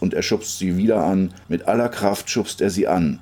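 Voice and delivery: ordinary delivery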